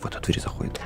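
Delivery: hushed whisper; whispering while hiding. Real, not acted. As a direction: keep it barely audible while sneaking. Sneaking past enemy in game.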